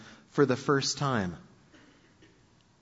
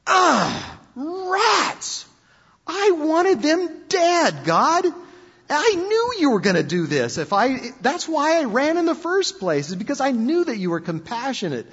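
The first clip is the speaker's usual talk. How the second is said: sulking Jonah; 8 semitones higher than usual. Retold or imitated. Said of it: "and he goes".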